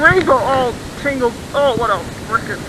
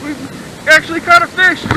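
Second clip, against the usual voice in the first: ordinary talk, nothing marked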